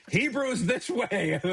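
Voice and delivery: high, slow, laughing sign announcement; mock sign. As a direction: read it out high like a silly neon sign, laughing. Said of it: "It's like".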